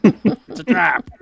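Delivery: high, slow alien voice, laughing. Retold or imitated. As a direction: croak it out like a sci-fi alien admiral. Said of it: "You did the alien voice".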